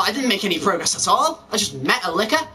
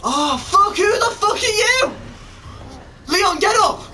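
high-pitched alarm; panicked shout; 8 semitones higher than usual. Real, not acted. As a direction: cry out urgently as it attacks. Monster attacks the game character.

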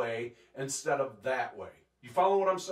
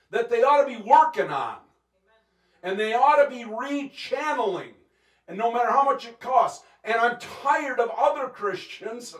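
loud, high, forceful sermon delivery; fired-up preacher in full flow; impassioned preaching; 7 semitones higher, 6 dB louder than usual. Real, not acted. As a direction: preach it hard, driving each phrase home.

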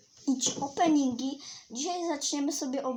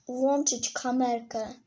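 normal talking voice

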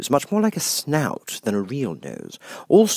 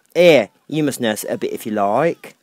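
put-on louder brusque old duchess; 7 dB louder than usual. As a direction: fling the baby at her gruffly.

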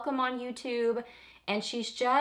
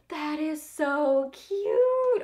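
delighted gush; 7 semitones higher, 6 dB louder, much slower than usual. Real, not acted. Reacting to mug saying.

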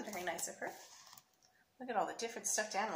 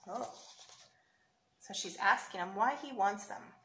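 plain reading voice

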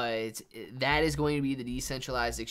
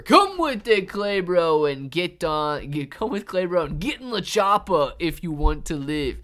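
loud, higher, hammed-up action hero; Terminator impression; 4 semitones higher, 9 dB louder than usual. Urging Clay Bro into the chopper, hammed-up Terminator voice. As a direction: bark the rescue line like a bad movie hero. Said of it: "My accents are the worst".

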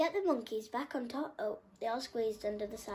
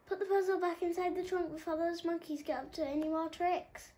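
high, hurried instruction; urgent bird; 8 semitones higher, 3 dB louder than usual. A narrator's reading. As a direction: rush the orders out. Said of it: "said Muriel".

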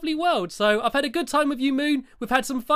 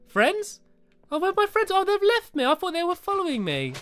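surprised dismay; 7 semitones higher than usual. Real, not acted. Realises moon friends have gone.

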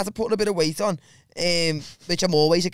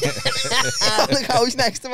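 laughing; 6 semitones higher, much slower than usual. Real, not acted. Laughing while telling.

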